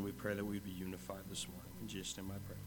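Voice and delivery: usual speaking voice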